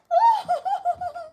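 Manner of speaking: high-pitched burst of real laughter. Real, not acted. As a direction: laugh out from the shock. Laughing after the scream.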